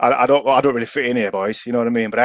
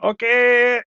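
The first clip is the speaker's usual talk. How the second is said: high, drawn-out little okay; mock meek okay; 12 semitones higher than usual. Driver as the man walks across, high meek okay. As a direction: squeak a wary acknowledgement.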